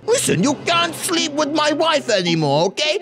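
high-pitched comic complaint; wronged husband. Pretend. Husband confronting his wife's lover, high squeaky indignation. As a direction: protest in a squeaky put-on voice.

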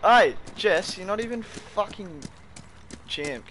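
calling out. Real, not acted. Calling out to teammate.

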